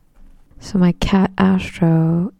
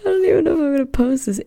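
laughing nervously; 9 semitones higher, much faster than usual. Real, not acted. Nervous giggling on first episode.

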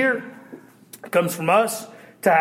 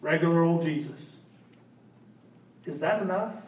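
soft reflection; 3 semitones lower, 7 dB quieter than usual. Real, not acted.

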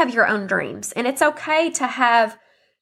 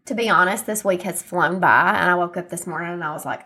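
ordinary talk, nothing marked

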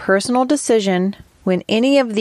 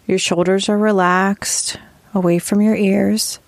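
guided meditation. Acted. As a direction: lead them gently through posture and breath, taking your time.

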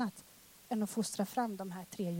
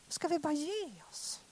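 same question, pitched higher and voiced; higher repeat; 4 semitones higher, 4 dB quieter than usual. Shown as a demonstration. Preacher repeats the question, higher than the whisper. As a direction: lift it up after the whisper. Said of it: "Eller ska vi bara ge oss?".

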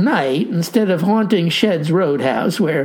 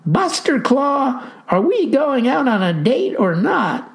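put-on young woman voice; 7 semitones higher than usual. A narrator's reading. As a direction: voice the young lady. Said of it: "and said".

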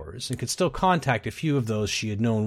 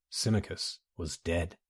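hushed despair; 5 semitones lower, 8 dB quieter, slower than usual. A narrator's reading. Refrain fading into despair.

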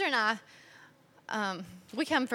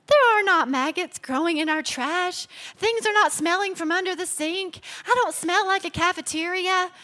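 indignant retort; 7 semitones higher, 4 dB louder than usual. Retold or imitated. Quoting herself answering husband.

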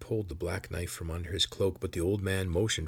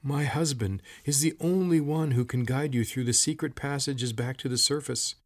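higher, strained, frustrated woman's voice; shaking with frustration; 6 semitones higher, 3 dB louder than usual. A narrator's reading. Old woman urging rescue of her husband, frustrated. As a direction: plead in agitated frustration. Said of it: "Her voice shook with frustration".

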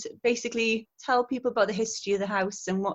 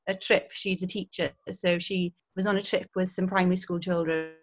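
normal talking voice

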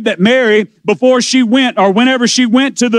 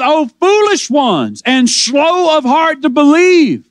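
shouted rebuke; 4 semitones higher, slower than usual. Acted. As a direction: call it out slowly like a preacher rebuking. Preacher crying out Jesus' rebuke.

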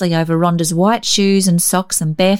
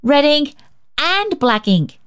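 put-on excited child; 8 semitones higher than usual. A narrator's reading. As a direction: dangle the treat. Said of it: "she exclaimed".

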